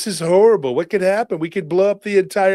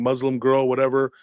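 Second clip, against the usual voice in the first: ordinary talk, nothing marked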